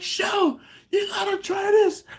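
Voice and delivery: very high, loud excited call; excited coworker. Retold or imitated. Coworker urging his wife to try the sauce, excited impression. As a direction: mimic him eagerly calling his wife over. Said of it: "He's like".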